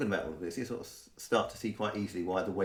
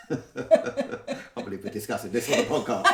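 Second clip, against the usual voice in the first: laughing; 4 dB louder than usual. Real, not acted. Laughing warning about podcast.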